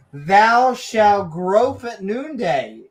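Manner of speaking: slow, loud booming prophet; mock biblical prophet. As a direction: proclaim it like an old preacher. Reading scripture in mock voice.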